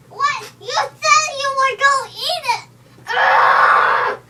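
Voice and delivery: high, loud pretend outrage; playful furious yell, high and loud; outraged shout. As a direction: yell at the one who broke a promise. Character betrayed over eaten egg.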